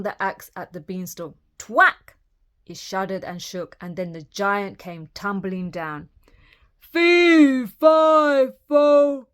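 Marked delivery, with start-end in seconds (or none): 1.6-2.2 high, loud impact word; sharp thwack. A narrator's reading. Imitating the axe blow. 6.8-9.3 loud, high, stretched chant; giant's bellow. A narrator's reading.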